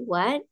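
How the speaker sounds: WHAT rising like a question; second tone. Shown as a demonstration. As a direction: let what glide upward. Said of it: "nada kedua berarti". (what dengan nada dua yang naik)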